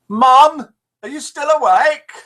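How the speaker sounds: high loud shout up the stairs; calling upstairs. Acted. Woman in the joke shouting upstairs to her mother, high and loud. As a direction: call up to mum upstairs.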